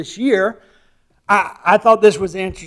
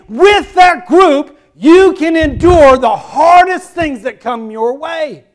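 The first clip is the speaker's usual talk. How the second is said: rising, louder preaching, slowing on the climax; full-force preaching; 7 semitones higher, 10 dB louder than usual. Acted. Preacher rallying the church to stand together, full-force preaching. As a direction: build the congregation up to a stirring peak.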